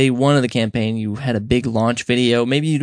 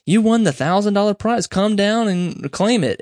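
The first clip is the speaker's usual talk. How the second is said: mock excited caller; 7 semitones higher than usual. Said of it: "It's like".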